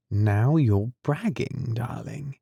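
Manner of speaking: ordinary delivery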